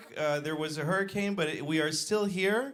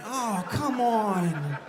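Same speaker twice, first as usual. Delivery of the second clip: slow, drawn-out mock whine; hammed-up put-upon moan, rising high; whining protest; 5 semitones higher, much slower than usual. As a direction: complain like it's terribly unfair. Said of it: "I'm like".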